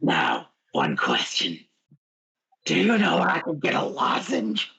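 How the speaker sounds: low, raspy monster growl; growly voice. Acted. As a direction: growl from a torn-up throat. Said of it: "I'm not doing the growly voice".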